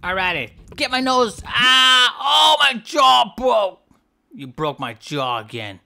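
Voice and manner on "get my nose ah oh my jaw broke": loud, higher pained shouts; pained yelling. Real, not acted. Fish player yelling as the whale bites his jaw. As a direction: yell as the jaw snaps.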